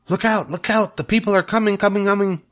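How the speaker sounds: high, urgent gander cry; gander shouting a warning. A narrator's reading. Gander warning the animals the people are coming. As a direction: raise the alarm like a goose. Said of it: "shouted the gander".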